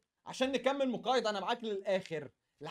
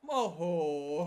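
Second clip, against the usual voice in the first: excited laugh; 7 semitones lower, much slower than usual. Real, not acted. Reacts to pack reveal.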